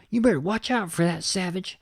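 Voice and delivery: high-pitched, blustering cowboy impression; hammed-up high voice of warning; cartoon cowboy warning. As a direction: warn him like a hot-tempered little gunslinger.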